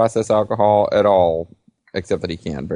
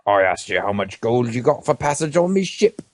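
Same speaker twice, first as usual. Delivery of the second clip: angry pirate; 4 semitones higher than usual. Acted. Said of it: "Angry as he asks again".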